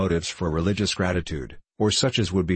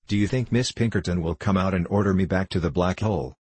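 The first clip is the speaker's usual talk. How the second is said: usual speaking voice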